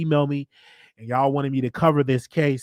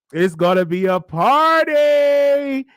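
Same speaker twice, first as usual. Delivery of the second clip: slow, higher, louder celebratory shout; party shout; 10 semitones higher, 7 dB louder, slower than usual. Real, not acted. Host hyping his audience about a win, slow shout. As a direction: hype it up like throwing a party.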